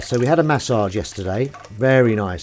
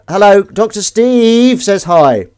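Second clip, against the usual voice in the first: big drawn-out on-camera hello; slow loud hello for the viewers; loud slow greeting; 8 semitones higher, 11 dB louder, slower than usual. Acted. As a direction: call out hello slowly and loudly.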